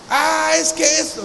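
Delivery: mocking excuse. Preacher mocking the excuse of someone doing their own will, mock whine. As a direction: mimic a flimsy excuse to ridicule it.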